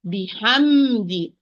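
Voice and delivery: بحمد slowed, the م held in between; slow recitation demo. Shown as a demonstration. Teacher models بحمد for student. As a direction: draw out بحمد giving the meem its time. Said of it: "Meme here, it's in between letter".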